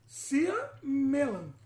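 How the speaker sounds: Cia melan drawn out very slowly, testing the swap; slow syllable swap. Shown as a demonstration. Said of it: "não é isso?".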